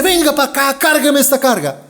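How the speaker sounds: loud, high soldier's command; barked order. Acted. Roman soldier orders a man to carry, barked order. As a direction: call out a gruff demand.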